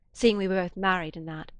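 ordinary delivery

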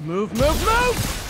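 rising urgent yell of move; urgent shout. Acted. Hero forcing way through.

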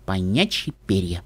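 low, soft letdown; deflated, quiet grumble; disappointed drawl. A narrator's reading. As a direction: draw it out in disappointment, dropping low. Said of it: "разочарованно протянула Скуталу".

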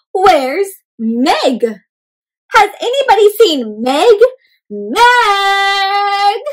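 high, loud searching call; calling for Meg. Acted. Shell calls out for missing Meg, high and loud. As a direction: shout across the store for Meg.

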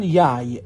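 یای with a y sound instead of j; Iraqi pronunciation. Shown as a demonstration. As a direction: say یای, the ج turned into ی. (تلفظ عراقی یای با ی به جای ج)

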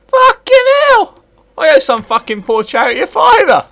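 loud high shout of disbelief; shouted amazement. Real, not acted. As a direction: yell it out, voice shooting up. Shock at getting past the bomb.

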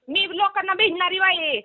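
ordinary talk, nothing marked

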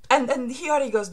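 usual speaking voice

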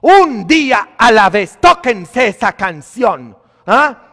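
loud, high preaching cry; fiery sermon delivery; full-force preaching. Acted. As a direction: preach it out to the whole church.

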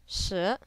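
shi sliding upward; rising tone on shi. Shown as a demonstration. Teacher models number ten for learners, rising shi. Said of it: "This is a rising tone".

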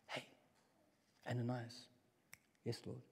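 hushed call and a whispered reply. Shown as a demonstration. Preacher whispers the call and Ananias's answer. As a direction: keep it to a whisper. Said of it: "when I whisper it".

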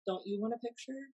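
usual speaking voice

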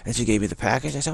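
normal talking voice

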